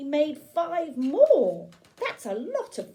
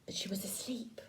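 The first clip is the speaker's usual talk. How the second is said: softly whispered, so as not to wake Nan; 12 dB quieter, faster than usual. Acted. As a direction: hush it like a bedtime secret. Hushed moment, Nan asleep.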